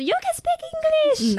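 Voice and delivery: very high mock amazed voice; surprised. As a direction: squeal with surprise at her English. Imitating the missionary's surprise.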